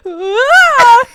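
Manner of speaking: sudden high yelp of a crash; mock scream. Sister crashing behind her, high loud yelp. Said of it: "I just hear".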